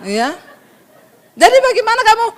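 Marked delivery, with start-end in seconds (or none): 1.3-2.3 high, loud question; high brash question. Shown as a demonstration. Wife practising on her husband, starts high and loud. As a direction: ask it pitched way up. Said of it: "nada belakangnya naik".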